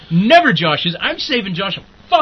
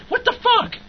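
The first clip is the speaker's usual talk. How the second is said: higher-pitched, sputtering anger; flustered rage; 6 semitones higher than usual. Real, not acted. Ranting to his co-host, sputtering in real frustration. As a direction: let the frustration choke the words. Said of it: "I'm mad now".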